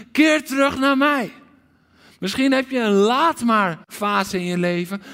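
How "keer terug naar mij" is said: high, loud cry of Keer; calling out. Acted. Preacher voicing God's call to his children, loud. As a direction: call them home with all your heart. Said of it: "De roep van Gods hart".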